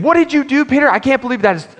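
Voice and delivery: high, sharp scolding mom voice, fast at the end; scolding mother. Retold or imitated. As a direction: play the shocked mother catching her son. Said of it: "I remember her catching that and saying".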